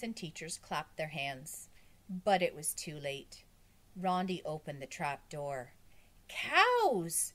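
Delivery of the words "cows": high, loud, drawn-out cry; exclaiming. A narrator's reading. Girl opens trap door and exclaims, high and loud. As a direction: call out the astonishing discovery. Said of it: "she exclaimed".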